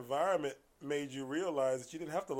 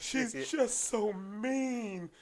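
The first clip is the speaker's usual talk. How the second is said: high, slow, drawn-out whine; hammed-up crybaby complaint; imitating bawling; 10 semitones higher, 6 dB louder, much slower than usual. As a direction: stretch it into a mock sob. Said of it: "this guy's balling".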